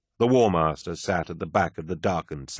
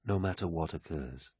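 soft, grave addition; quiet appeal; 9 dB quieter than usual. A narrator's reading. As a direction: add it quietly. Horus softly presses for loyalty.

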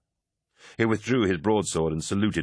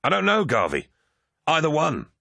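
high, urgent outburst; keyed-up onlooker; excited exclamation; 9 semitones higher, 4 dB louder than usual. A narrator's reading. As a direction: exclaim it, agitated and high-pitched. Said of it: "Tarvitz exclaimed".

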